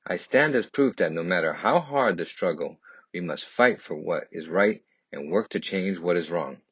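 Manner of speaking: ordinary delivery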